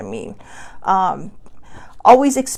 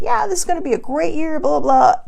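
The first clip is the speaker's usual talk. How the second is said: mock cheerful; 6 semitones higher, 3 dB louder than usual. Mock optimistic new-year voice.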